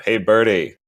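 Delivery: shout. Acted. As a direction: shout down the stairwell. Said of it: "just kinda shout".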